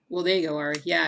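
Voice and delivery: ordinary talk, nothing marked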